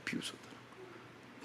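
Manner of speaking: whispered, barely there; sneering whisper. Shown as a demonstration. Preacher echoes the crowd's mockery, in a sneering whisper. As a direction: breathe it out like a sneer.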